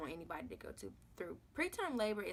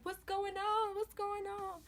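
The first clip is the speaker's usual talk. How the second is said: much higher, bewildered repeated question; flustered mom; 12 semitones higher, slower than usual. Retold or imitated. Fiance's mom bursting into the delivery room, high and confused. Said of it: "his mom was like".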